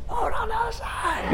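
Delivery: very high, soft mock shout; tiny high-pitched distant call; faint far-off yell. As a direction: holler as if barely heard from shore. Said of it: "kind of a good distance to yell".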